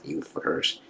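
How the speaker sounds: muttered curse. Retold or imitated. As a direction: grumble it under your breath. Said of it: "It's like".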